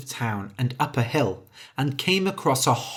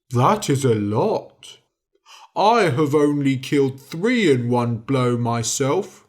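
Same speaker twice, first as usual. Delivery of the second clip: slow, loud giant voice; 4 dB louder, slower than usual. A narrator's reading. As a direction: boom it like an impressed giant.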